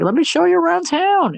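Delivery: way up in pitch, chirpy tour guide; showing the kids around town, high and chirpy. Retold or imitated. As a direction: pitch up like a cheerful host. Character voiced in retelling.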